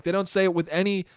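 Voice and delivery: usual speaking voice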